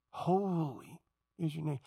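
hushed awe before a holy God; reverent hush. Shown as a demonstration. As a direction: breathe it out in awe. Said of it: "Jesus sets up the tone".

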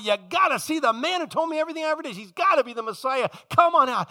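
fast, high, eager call to the town; excited calling out. Retold or imitated. Woman calling the townsfolk to come see. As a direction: rush out the news and call them all.

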